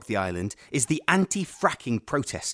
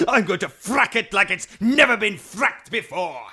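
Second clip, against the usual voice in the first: gloating; 8 semitones higher, 5 dB louder than usual. Acted. Villain gloats, building to climax.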